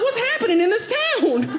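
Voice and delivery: high, loud bafflement; can't believe what you're seeing; bewildered. Retold or imitated. Comic staring at horses at the gas pumps, baffled. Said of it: "I was like".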